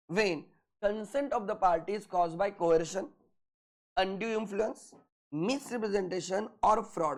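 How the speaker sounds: very slow, word by word dictation; dictating notes. Shown as a demonstration. Teacher to class, dictating notes very slowly. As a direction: spell it out slowly so they can write.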